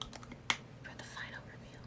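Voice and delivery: breathy dramatic whisper. Real, not acted. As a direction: hush it for the big reveal.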